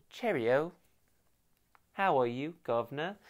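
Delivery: hammed-up British "guv'nor" greeting; jokey tea-sipping Brit accent; mock British accent. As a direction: put on a playful English accent. Joking British tea-sipping bit.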